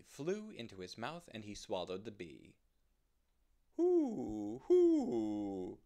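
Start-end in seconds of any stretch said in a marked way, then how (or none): 3.8-5.8 loud, long owl hoots; hooting owl. Acted. Fat old owl calls to a yawning rabbit, hooting loudly. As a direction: hoot out big and booming like an owl. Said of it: "said the fat old owl".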